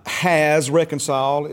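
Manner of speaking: "has" punched loud and drawn out; slow, weighty stress on "has"; emphasis on HAS. Shown as a demonstration. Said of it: "put emphasis on the words".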